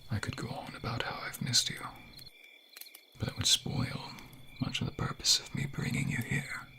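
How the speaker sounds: soft airy whisper; tender whisper. Acted. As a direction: hold her close and whisper. Intimate confession.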